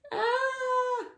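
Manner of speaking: sudden high yelp; high shout of alarm. Real, not acted. Reader yelping as Dill dives. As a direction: cry out in alarm.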